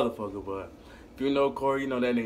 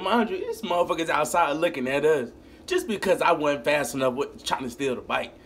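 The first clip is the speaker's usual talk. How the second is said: normal talking voice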